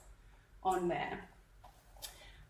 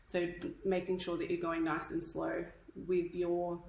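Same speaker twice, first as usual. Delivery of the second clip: usual speaking voice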